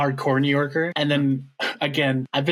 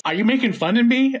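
higher, put-on offended question; person calling out mimicry; 10 semitones higher, 4 dB louder than usual. The person on the phone confronts a mimicker, offended. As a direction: challenge the suspected mimic, higher pitched. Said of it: "until the person says".

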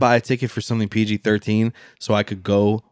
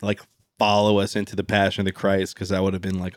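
ordinary talk, nothing marked

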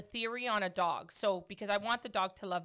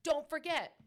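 put-on higher, earnest little-kid voice; imitating a child; 6 semitones higher than usual. Child reading mom's board back to her, earnest reminder. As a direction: remind a parent in a raised, insistent kid voice. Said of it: "So he's like".

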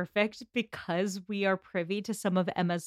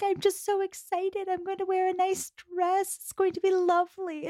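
much higher, sweet giddy chatter; giddy Jane; 10 semitones higher than usual. Retold or imitated. Imitating Jane planning her dress, high and giddy. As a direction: squeal it like a girl picking a dress.

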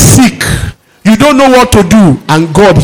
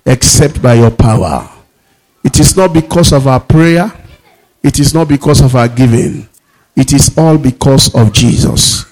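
prayer; 5 semitones lower than usual. Acted. Leads the church in prayer.

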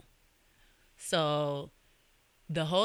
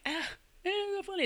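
very high, put-on squeal; mock amusement. Imitating people laughing at a viral meme, mock-amused squeal. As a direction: squeal it like the mocking online crowd. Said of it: "everybody was laughing at it, making jokes about it".

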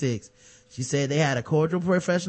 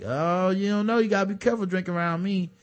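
lightly voiced comedian's comeback, pitched up; higher, quickening mock retort; imitating Cosby; 5 semitones higher than usual. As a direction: playfully imitate a joking reply to a heckler. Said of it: "And he was like".

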